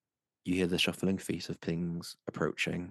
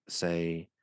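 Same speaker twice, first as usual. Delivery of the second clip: usual speaking voice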